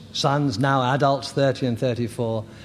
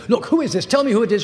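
ex-wife's sharp demand, raised higher and louder; got angry; 8 semitones higher, 5 dB louder, faster than usual. Retold or imitated. Ex-wife on the phone demanding the caller's name, angry. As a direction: snap at the unknown caller, annoyed. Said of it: "and then she got angry".

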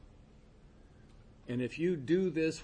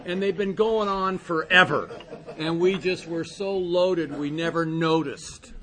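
emphatic; 4 semitones higher, 10 dB louder than usual. Real, not acted. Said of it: "emphatic point".

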